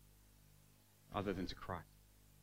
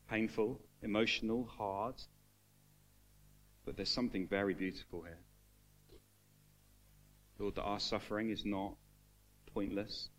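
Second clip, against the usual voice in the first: prayer; 2 semitones lower than usual. Acted. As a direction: pray over the room gently. Leads closing prayer.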